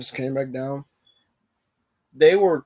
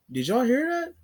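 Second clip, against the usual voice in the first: high, startled question; retold alarmed question; 11 semitones higher than usual. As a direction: ask it sharply, pitched way up, spooked. Hearing a door slam at night.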